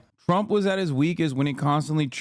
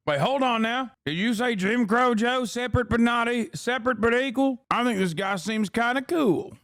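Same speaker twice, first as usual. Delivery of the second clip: mock old viewer; 7 semitones higher than usual. Mocking old viewer reacting to Vance.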